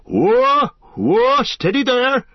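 high, loud "Whoa!"; calling out. Acted. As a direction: halt them with a loud call. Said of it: "cried the old man".

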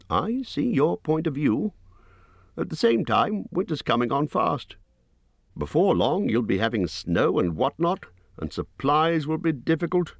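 a reasonable little hobbit making his case; Bilbo's voice. A narrator's reading.

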